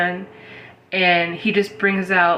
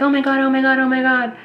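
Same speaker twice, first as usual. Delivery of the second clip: higher-pitched mock panicked cry; mock scream; 6 semitones higher, faster than usual. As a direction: imitate someone screaming as they run, without full volume. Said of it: "scream running".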